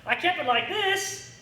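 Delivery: high, loud, flustered cry of confusion; mock panic. Instructor mimicking a flustered student at the range, mock panic. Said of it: "you're gonna be like".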